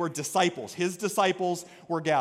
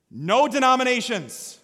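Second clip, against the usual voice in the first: ordinary delivery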